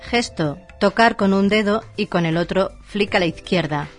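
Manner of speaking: ordinary delivery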